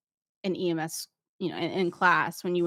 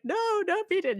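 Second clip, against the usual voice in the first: high-pitched soap-opera outcry; mock-hysterical high cry; very dramatic; 12 semitones higher than usual. Instructor beating on the wall in a scenario, very dramatic. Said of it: "being very dramatic".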